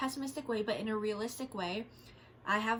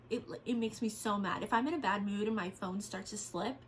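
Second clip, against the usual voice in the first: normal talking voice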